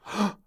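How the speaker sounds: sharp, high gasp; shocked gasp. A narrator's reading. Maid finds bed empty.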